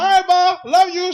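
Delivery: high, loud sing-song goodbye; mock sweet goodbye. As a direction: call it out sweetly to mom. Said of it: "I'm like".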